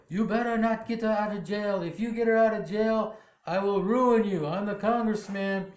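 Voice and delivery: threatening congressman. Retold or imitated. Congressman threatens Elisa's dad.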